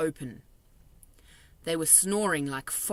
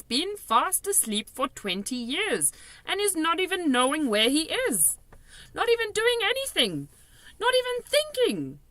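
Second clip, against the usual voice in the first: giant voice climbing much higher; BFG, building excitement; 11 semitones higher, 4 dB louder than usual. A narrator's reading. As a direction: giant getting carried away, pitch soaring.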